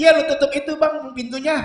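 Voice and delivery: yelling back. Retold or imitated. Wife yelling back to husband from the bathroom, loud retort. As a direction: shout it back without looking up.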